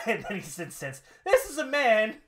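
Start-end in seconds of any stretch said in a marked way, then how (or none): 1.2-2.2 loud, high indignant promo; shouted wrestler grievance; outraged rant. Acted. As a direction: rail in mock disbelief. Acting Rusev's rant about Roode.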